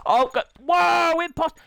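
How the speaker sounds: high, loud drawn-out whoa; loud startled outburst; startled shout. Real, not acted. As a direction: yell out in sudden surprise. Startled by game imposter.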